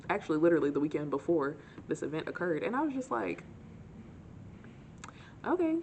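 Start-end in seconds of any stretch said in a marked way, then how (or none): 5.5-5.8 high surprised okay. Retold or imitated. Reacting to odd compliment.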